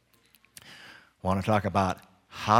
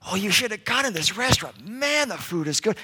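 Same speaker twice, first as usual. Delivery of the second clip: acted rave; 9 semitones higher than usual. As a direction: gush like a friend recommending a find. Acted rave about a restaurant.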